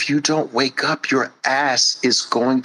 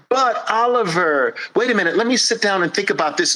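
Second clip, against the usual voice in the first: high, pleading whine; mock protest, high and nasal; whiny excuse; 7 semitones higher, faster than usual. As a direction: whine like a stalling child. Whiny objector.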